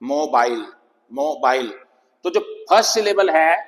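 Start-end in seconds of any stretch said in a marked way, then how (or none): 0.0-1.7 मोबाइल as two parts, मो and बाइल; split syllables. Shown as a demonstration. Teacher showing pupils two syllables, split syllables. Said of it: "मो अलग है".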